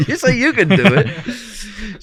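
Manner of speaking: laughing hard, words drawn out; helpless laugh running under the words. Real, not acted. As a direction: say it through laughter. Laughing after the attempted accent impression.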